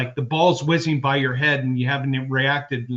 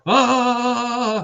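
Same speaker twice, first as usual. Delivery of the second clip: high, sustained mock scream; long high-pitched "aah"; imitated scream; 9 semitones higher than usual. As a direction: cry out "ah" like a body in alarm. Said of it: "it was probably a more".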